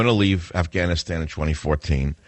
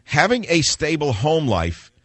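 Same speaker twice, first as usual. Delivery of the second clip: scornful read-aloud; 5 semitones higher than usual. Retold or imitated. Host quotes "this leftist moron" to listeners, scornful. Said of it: "Listen to the rest of this crap".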